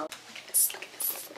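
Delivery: hushed whisper, barely voiced. Real, not acted. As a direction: whisper it like a secret find in the shop. Showing a find in store.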